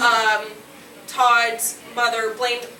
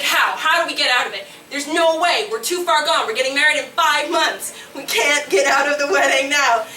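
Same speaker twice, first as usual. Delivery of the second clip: louder, pressing questions; anxious inner voice; 4 semitones higher, 6 dB louder than usual. Retold or imitated. Inner voice panicking about the wedding, louder. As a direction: fret out loud like a cornered inner voice. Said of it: "the other voice is like".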